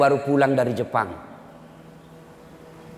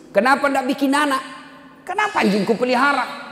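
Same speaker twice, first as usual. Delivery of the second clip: incredulous question; 11 semitones higher than usual. Retold or imitated. Preacher challenging Japanese dog owner.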